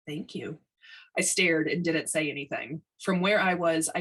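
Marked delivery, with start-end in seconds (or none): 0.1-0.6 soft, gentle answer; a quiet, pleasant thank you; low pleasant voice. A narrator's reading. New radio tech politely thanks the boss, softly. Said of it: "I heard a low, pleasant voice answer".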